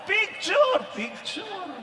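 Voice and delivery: high, eager begging for a photo; eager pleading. Retold or imitated. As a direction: beg for the picture excitedly. Old woman begging for photo.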